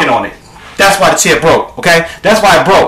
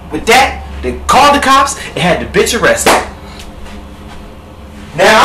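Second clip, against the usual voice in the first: usual speaking voice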